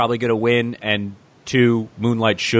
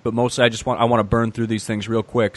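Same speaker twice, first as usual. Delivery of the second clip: usual speaking voice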